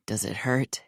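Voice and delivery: low, soft, harsh question. A narrator's reading. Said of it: "his voice somehow deeper, harsher".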